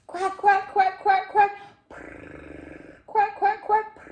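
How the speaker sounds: loud, high duck quacks; noisy excited duck calls; duck quacking. Acted. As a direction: quack in quick high bursts. Duck calls in read story.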